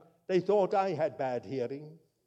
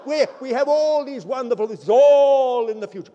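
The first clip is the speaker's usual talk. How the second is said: preaching at full force; 2 semitones higher, 9 dB louder than usual. Acted. Preacher rousing the congregation about the millennium, loud and fervent. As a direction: lift the congregation with fervour.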